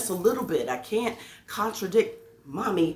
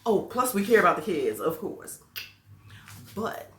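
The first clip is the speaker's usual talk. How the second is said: usual speaking voice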